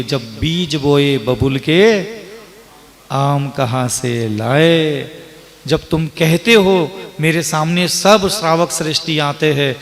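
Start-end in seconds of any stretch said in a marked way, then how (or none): 0.0-5.1 very slow, drawn-out recitation; measured, lower-pitched proverb delivery; recited proverb. Real, not acted. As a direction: stretch out each word of the saying.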